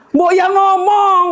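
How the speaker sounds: high, slow Javanese-flavoured chiding; Javanese reproach. Retold or imitated. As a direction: chide him drawn out and high. (menegur dengan logat Jawa, pelan dan tinggi)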